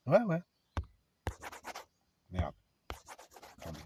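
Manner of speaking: ordinary delivery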